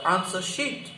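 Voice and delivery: ordinary delivery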